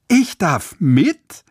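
höher, lauter und langsam vor Freude; freudig ungläubig. Acted. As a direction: die Frage vor Glück kaum fassen. Außer sich vor Freude. (Jojo fragt aufgeregt vor Freude, ob er mit darf)

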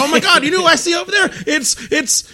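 high, fast excited rush. Retold or imitated. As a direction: gush like you spotted a friend. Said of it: "I was so excited".

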